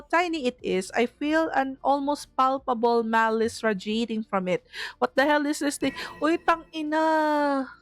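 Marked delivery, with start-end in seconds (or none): none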